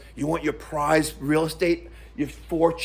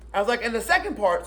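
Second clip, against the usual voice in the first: ordinary talk, nothing marked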